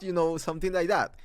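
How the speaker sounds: normal talking voice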